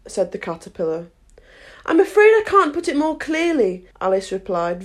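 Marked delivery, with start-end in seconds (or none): 1.9-3.9 put-on higher-pitched polite girl; mildly apologetic courtesy. A narrator's reading. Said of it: "very politely".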